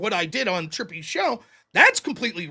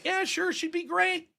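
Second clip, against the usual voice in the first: fake polite agreement; 6 semitones higher, 8 dB quieter than usual. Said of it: "and I was like".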